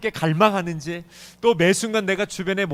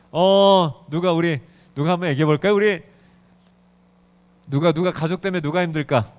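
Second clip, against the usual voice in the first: ordinary talk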